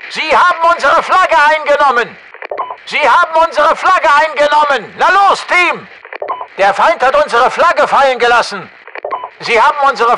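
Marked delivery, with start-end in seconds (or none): 0.1-5.9 high, loud battle yell; high-pitched military alarm shout. Acted. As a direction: urgently alert the team. Shouted alarm over taken flag.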